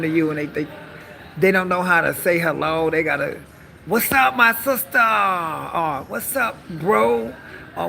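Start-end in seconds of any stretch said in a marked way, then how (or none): 3.9-5.0 higher, louder try-hard greeting; mock forced slang greeting. 6.1-7.3 slow, higher try-hard bro; mock slang greeting.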